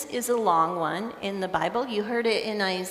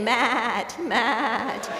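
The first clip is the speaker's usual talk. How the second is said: imitated sheep calling "Matt"; sheep bleating a name. Preacher mimics sheep calling their shepherd, wavering bleat. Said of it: "They're saying, 'Matt".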